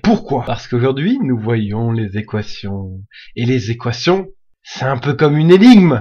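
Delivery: low, hushed, mysterious tone; a hint of a secret agent briefing. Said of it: "je fais une voix d'agent secret".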